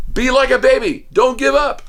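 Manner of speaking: high-pitched rallying chant; punchy slogan for a room of kids; class slogan. Shown as a demonstration. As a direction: call it out high like a class motto. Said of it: "So we say it".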